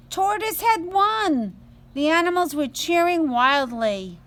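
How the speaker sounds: storyteller celebrating the surprise ending; excited reveal. A narrator's reading. Story climax announced.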